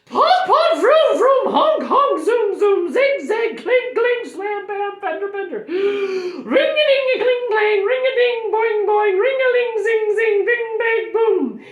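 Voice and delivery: very high, loud barrage of noise words; frantic high-pitched shouting; explosive outburst. Acted. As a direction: squeal out every sound effect at full tilt. Teacher bursts out after deep breath.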